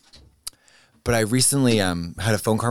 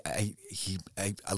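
usual speaking voice

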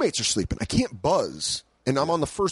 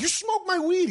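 outraged. Retold or imitated. Learning friend smoked his weed.

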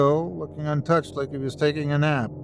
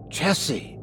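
gasped; 3 semitones higher, 3 dB quieter than usual. A narrator's reading. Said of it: "she gasped".

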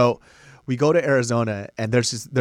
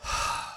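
long heavy breath out before a story; imitated dramatic sigh; 7 dB quieter than usual. Imitating a testifier's opening, dramatic sigh. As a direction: sigh theatrically to open a testimony. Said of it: "He goes like this".

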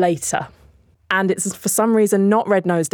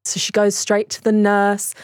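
normal talking voice

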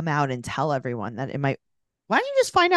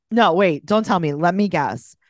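ordinary delivery

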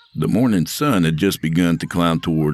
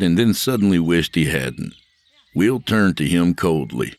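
ordinary delivery, nothing marked

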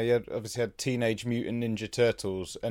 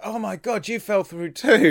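acted amazement; 10 semitones higher, 6 dB louder than usual. Stranded engineer greeting a fellow faller, acted amazement. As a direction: exclaim it high and loud, stretching the end. Acting the engineer meeting the newcomer.

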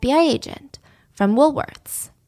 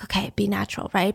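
hushed unvoiced self-talk; whispering to herself; 3 semitones lower, 8 dB quieter, faster than usual. Scared girl whispers to herself while shoplifting. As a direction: whisper it to calm your nerves. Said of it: "She's like".

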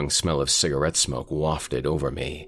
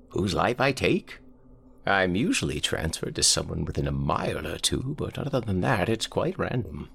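put-on old man voice; 4 semitones higher than usual. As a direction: croak it like a frail old man.

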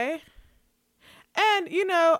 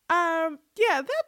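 ordinary talk

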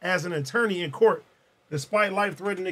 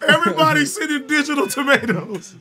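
higher-pitched rallying cry; rally the whole audience; hyped-up call; 5 semitones higher, 4 dB louder than usual. Real, not acted. Host urging chat to send digital tomatoes, hyped-up.

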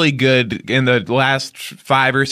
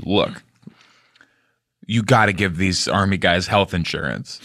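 serious voice; 5 semitones lower than usual. Retold or imitated. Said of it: "he got serious and he said".

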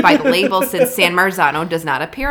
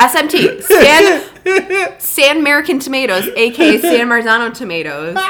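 loud, high interjection; mock insistence; 7 semitones higher, 7 dB louder than usual. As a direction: butt in loudly to add the missing letter. Jokingly insisting on the brand name.

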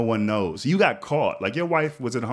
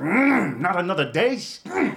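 high, musing hum over a lost deal; half regretful, tempted mm; wistful mm; 7 semitones higher, slower than usual. Retold or imitated. Alone in his car, tempted and musing on the offer. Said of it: "I was like".

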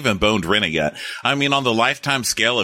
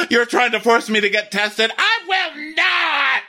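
very high, hammed-up outraged shriek; wife shrieking at the husband about testing, high mock outrage; mock gasp and shout. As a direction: gasp then shriek in offense. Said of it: "And she's like".

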